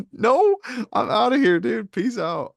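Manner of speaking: high-pitched, loud comic bail-out; mock alarmed refusal. Podcaster voicing a viewer refusing the vest, mock alarm. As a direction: play at bolting in disbelief, voice shooting up.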